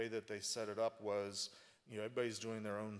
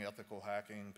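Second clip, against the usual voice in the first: ordinary talk, nothing marked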